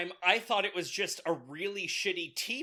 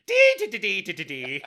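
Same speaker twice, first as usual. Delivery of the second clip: mock Italian accent gibberish; 10 dB louder, faster than usual. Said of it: "Listen to my Italian accent".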